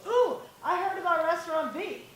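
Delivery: husband's eager reply. Retold or imitated. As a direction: imitate the husband brushing her off cheerfully. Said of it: "And his response was".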